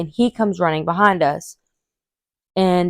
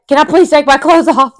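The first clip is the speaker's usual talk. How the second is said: higher, louder plea with a laugh; desperate plea; 8 semitones higher, 6 dB louder than usual. Retold or imitated. Labouring woman asking the nurse, loud laughing plea. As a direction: beg to strip off, laughing through it. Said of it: "I'm like".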